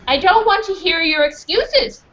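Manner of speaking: angry high dismissal. Acted. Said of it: "Missus Crutch said".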